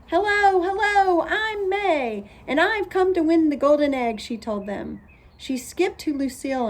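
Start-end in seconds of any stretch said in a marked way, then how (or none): 0.1-4.2 high, loud, bouncy hello; eager greeting. A narrator's reading. May greets Lucille's family, high and bubbly. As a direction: greet them like you own the place.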